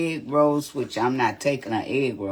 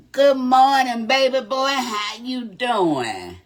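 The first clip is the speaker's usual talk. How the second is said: sing-song greeting; 10 semitones higher, 6 dB louder than usual. Real, not acted.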